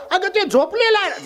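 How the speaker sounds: very high-pitched acted objection; indignant, high-voiced wife; protesting. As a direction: protest at being disturbed. Wife protests husband is sleeping.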